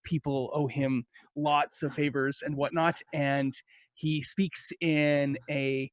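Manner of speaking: ordinary delivery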